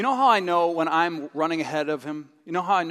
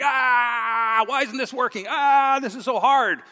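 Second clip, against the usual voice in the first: frustrated straining; 5 semitones higher, slower than usual. Retold or imitated. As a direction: huff it out like a struggling worker.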